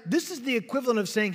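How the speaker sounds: ordinary delivery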